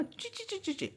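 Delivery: high tiny squeaks; mouse squeak. Shown as a demonstration. Teacher to pupils, squeaking softly like a mouse. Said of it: "आप लोगों ने चूहे को सुना होगा ना".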